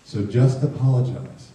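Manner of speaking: usual speaking voice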